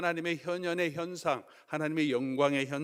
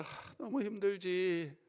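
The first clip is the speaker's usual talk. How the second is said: mock-gentle comforting God; 5 semitones higher than usual. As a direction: ease his pain tenderly. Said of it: "이렇게 어프로치 하지 않으시고".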